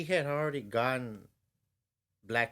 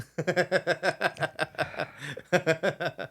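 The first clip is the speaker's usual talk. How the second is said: long, loud laugh; laughter; 6 semitones higher, 3 dB louder than usual. Real, not acted. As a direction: laugh hard at the joke. Laughing at kid-sized joke.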